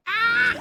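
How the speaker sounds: sudden high shriek; loud scream. Real, not acted. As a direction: yelp out in fright. Sudden loud cry in game.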